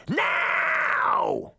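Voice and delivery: high, stretched shouted command; princess's roar. A narrator's reading. Princess orders the sentence carried out, high roar. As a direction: shriek it as a furious royal. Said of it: "she roared".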